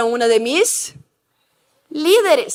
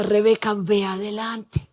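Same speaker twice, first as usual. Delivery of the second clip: quiet inner voice; 6 semitones lower, 6 dB quieter than usual. Retold or imitated. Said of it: "esa voz continuaba".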